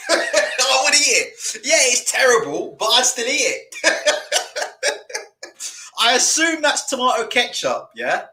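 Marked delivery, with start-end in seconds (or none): 3.8-5.9 laughing. Real, not acted. Laughing at the plate.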